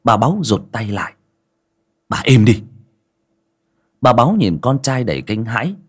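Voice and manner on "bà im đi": a curt, louder order to shut up; angry order. A narrator's reading. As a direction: cut her off hard. Son silences his lying mother.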